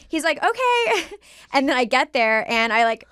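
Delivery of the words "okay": high, drawn-out okay; 1 bemused okay. Retold or imitated. He answers her surprise visit, a high bemused okay. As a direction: let it rise high, a little baffled. Boyfriend's reply to her text.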